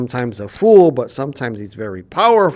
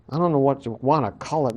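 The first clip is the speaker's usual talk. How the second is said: usual speaking voice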